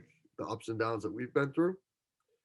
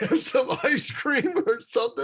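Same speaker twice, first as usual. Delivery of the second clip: laughing; 12 semitones higher, 4 dB louder than usual. Real, not acted. Laughs mid-sentence about treats.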